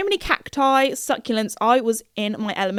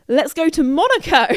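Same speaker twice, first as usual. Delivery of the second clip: louder, higher rallying cry; gleeful, raised-voice proposal; excited announcement; 5 semitones higher, 5 dB louder than usual. Retold or imitated. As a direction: announce the big plan to your friends. Acting out the group's excited decision.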